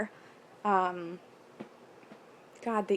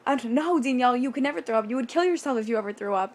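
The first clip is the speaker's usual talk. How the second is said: fast, raised inner-voice warning; retold anxious self-talk, starting higher and louder; mock alarm; 3 semitones higher, 4 dB louder, faster than usual. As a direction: scold yourself in a rush. Acted inner voice warning herself.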